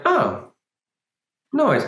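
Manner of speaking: high, breezy shrug of a voice; unbothered. Pretend. A relaxed listener shrugging off a knock, high and breezy. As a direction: toss it off lightly, pitched high. Calm self meeting a knock.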